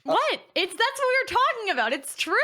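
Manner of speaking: high-pitched, insistent self-defence; indignant protest. Real, not acted. Defending a remark to scandalised friends, indignant. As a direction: defend yourself, pitch leaping up. Protesting after being called out.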